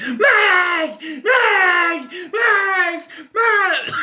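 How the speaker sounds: imitating a squealy, very high scream; mock-shriek the name over and over, full volume; screechy yelling. Imitating Heather at the end of the film, screechy yelling. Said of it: "all she was doing is yelling".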